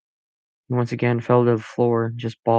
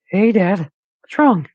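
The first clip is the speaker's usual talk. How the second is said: put-on higher, louder boyish voice; teen boy voice; 9 semitones higher, 5 dB louder than usual. A narrator's reading. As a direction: voice the young son, worried. Max voiced by narrator.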